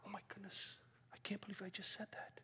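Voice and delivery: hushed, shocked whisper to yourself; whispered under breath. Retold or imitated. Missionary in Africa catches himself swearing, whispered under his breath. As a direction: whisper it, barely audible. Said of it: "I swear under my breath".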